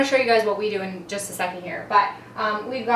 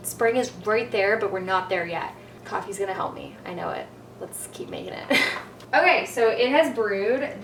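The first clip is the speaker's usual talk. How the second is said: normal talking voice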